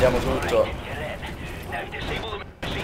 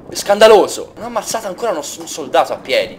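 ordinary talk, nothing marked

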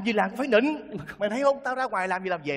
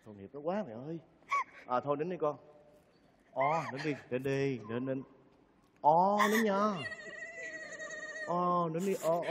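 soothing; 3 semitones lower, 9 dB quieter than usual. Acted. Comforting the crying girl.

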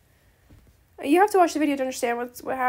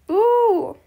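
excited ooh; 6 semitones higher, 7 dB louder than usual. Real, not acted. Excited reaction to game item.

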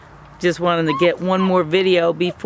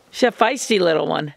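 ordinary talk, nothing marked

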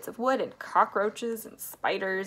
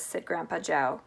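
ordinary delivery

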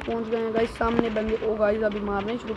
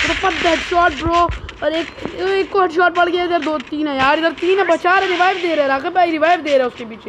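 high, loud mid-fight shouting; frantic squad callouts; 6 semitones higher, 5 dB louder than usual. Real, not acted. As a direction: urgently yell directions at your teammates. (घबराहट भरे जोश में ज़ोर से)

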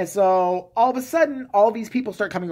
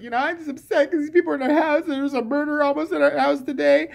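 much higher, lightly whiny complaint; mock whiny wife; 9 semitones higher than usual. Wife complains to husband about strangers, mock whiny. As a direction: pitch it up like a fed-up housewife.

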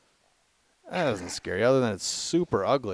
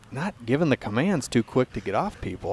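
ordinary talk

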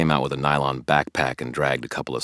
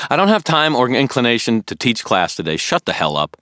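sharp, loud, higher outburst; temper flaring; 10 semitones higher, 11 dB louder than usual. Acted. Harry cutting Billy off, suddenly angry and loud. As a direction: snap it out, fed up with the questions. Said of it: "my temper flared".

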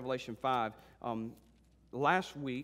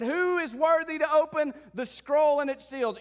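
high, loud proclamation; ringing, forceful herald's cry; strong angel; 10 semitones higher, 8 dB louder, slower than usual. Acted. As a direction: call out the challenge to all. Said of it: "this strong angel has said".

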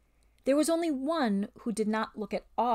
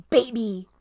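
louder spiteful hiss; 7 dB louder than usual. Acted. Ape hisses at the lamb, spiteful. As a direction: spit the word at him. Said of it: "he hissed".

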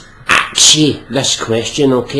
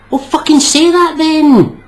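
much higher, exasperated snap; exasperated outburst; 10 semitones higher, 3 dB louder than usual. Acted. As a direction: throw up your hands in irritation.

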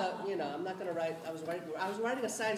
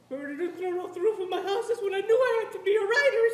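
emotional reading; 14 semitones higher, 12 dB louder than usual. Retold or imitated. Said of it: "of course I'm crying like this".

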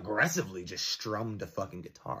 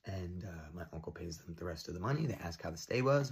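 ordinary delivery, nothing marked

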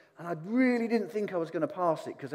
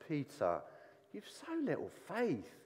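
softer and slow, said with a smile; kind and loving; 8 dB quieter, slower than usual. Shown as a demonstration. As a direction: gently tease him while lifting him up. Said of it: "saying it with a smile on his face".

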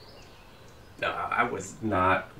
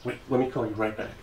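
ordinary talk, nothing marked

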